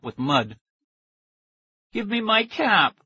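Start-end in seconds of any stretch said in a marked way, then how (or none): 1.9-3.0 put-on high, slow child's plea; higher, slower pleading child; pleading little boy. A narrator's reading. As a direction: beg for the cap like a small kid. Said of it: "the little boy pleaded".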